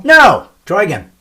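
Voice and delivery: loud playful no. Acted. Host shouts down his son's wrong guess with a playful loud no.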